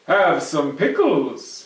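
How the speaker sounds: loud, slow friendly offer; Herschel offering. Acted. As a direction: tempt the goblin. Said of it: "he said to the goblin".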